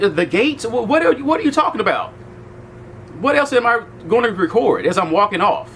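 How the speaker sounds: high-pitched, incredulous retelling of a challenge; indignant questions, pitched well up, as if arguing; exasperated retort. Retold or imitated. Telling off a guard over recording, exasperated retelling.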